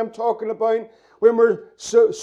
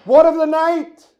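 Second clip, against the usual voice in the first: raised, higher pleading call into the dark; pleading cry; 5 semitones higher, 5 dB louder than usual. Acted. Preacher voicing the congregation's cry, a loud pleading call. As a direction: cry it out like someone lost in the night.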